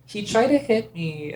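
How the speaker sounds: high, slow, cutesy femme voice to security. Acted. Playing a cutesy persona to security, high and slow. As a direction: play it sweet and girly, slowly. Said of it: "my character was very cutesy femme".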